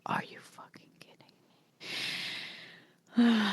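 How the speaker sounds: whispered, seething aside; incredulous whisper. Retold or imitated. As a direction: hiss it under your breath in disbelief. Retold silent outrage at boyfriend.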